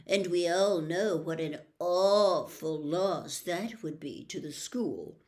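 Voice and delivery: cold, superior gentleman's voice; a smooth snob enjoying the bad news; sneering aristocrat. Malfoy hands Dumbledore the suspension order, smug and superior.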